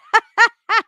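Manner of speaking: loud high cackle of laughter; laughing. Real, not acted. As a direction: let a big laugh out. Laughs at Siri fart comment.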